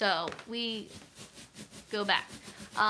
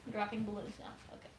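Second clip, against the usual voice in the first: normal talking voice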